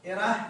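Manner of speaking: إره said sharply and loud as the example form; say the example word. Shown as a demonstration. A teacher sounding out إره for students. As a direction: call out إره as the pattern example. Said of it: "فصار وزن على وزن".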